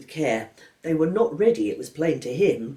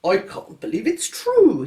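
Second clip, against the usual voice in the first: slow, louder gasp of disbelief; father can't believe it, slow and stunned; 9 semitones higher, 7 dB louder, slower than usual. A narrator's reading. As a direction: say it as if you can't trust your ears. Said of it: "he said".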